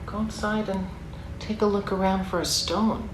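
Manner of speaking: ordinary delivery, nothing marked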